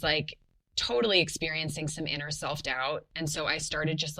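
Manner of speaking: normal talking voice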